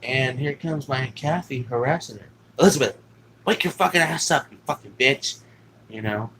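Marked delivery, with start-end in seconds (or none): 2.6-5.4 louder, higher mock yell; retold angry yelling. Aunt screaming at sleeping Elizabeth to wake up, mock yell. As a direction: bark her awake like the aunt.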